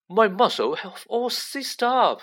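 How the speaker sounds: high, aching complaint; groaning. A narrator's reading. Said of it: "he groaned".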